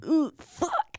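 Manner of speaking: high-pitched mock alarm; hammed-up high 'oh no' reaction. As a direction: squeal the dread, then punch the curse. Reacting as the song starts.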